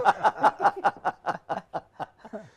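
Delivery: big burst of laughter; loud hearty laugh. Real, not acted. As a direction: laugh out loud, high and long.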